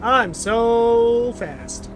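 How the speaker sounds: very slow, drawn-out brag; ironic boast. Real, not acted. Player mocking his slow creature, drawn out. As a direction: stretch it out, mocking his own speed.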